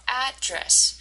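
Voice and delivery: "address" with AD emphasized; first-syllable stress. Shown as a demonstration. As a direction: lean on AD in "address". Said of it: "the first part".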